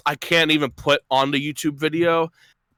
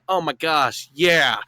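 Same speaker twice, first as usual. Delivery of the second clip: imitating half-hearted interest; unenthusiastic playing along; slower than usual. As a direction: feign mild enthusiasm without feeling it. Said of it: "she's not really feeling it, but she's, like, playing along".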